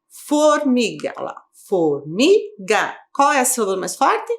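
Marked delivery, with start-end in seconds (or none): 0.1-1.1 "Formiga" drawn out slowly; syllable separation. Shown as a demonstration. Teacher helping pupils find the strong syllable, slow syllable separation. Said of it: "a gente faz a separação de sílabas". 1.7-3.0 "Formiga" very slowly, broken into for, mi, ga; syllable separation. Shown as a demonstration. Teacher separating syllables for pupils, very slow syllable by syllable. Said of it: "a gente faz a separação de sílabas".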